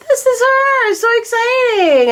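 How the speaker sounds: very high, gushing squeal of delight; excited squeal. Retold or imitated. Retelling meeting her twin flame, a high excited squeal. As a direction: squeal it like you just found your soulmate. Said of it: "I was like".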